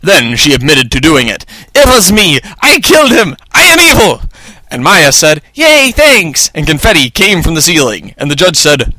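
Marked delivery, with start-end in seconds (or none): 1.8-4.2 high villainess outburst, climbing higher; hammed-up confession. A narrator's reading. 5.5-6.5 high-pitched little cheer; happy Maya. A narrator's reading. Maya celebrating the win.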